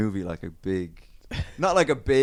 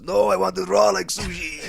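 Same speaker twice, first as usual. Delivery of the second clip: higher, put-on voice of the guy in the joke; acted Japanese guy; 8 semitones higher, 3 dB louder than usual. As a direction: voice the punchline guy, a bit higher. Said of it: "And he goes".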